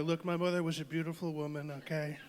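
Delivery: mock public-figure impression, insistent; Donald Trump. Fondly remembering his mother, parody boasting. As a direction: boast in a parody politician's cadence. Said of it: "Trump, yeah".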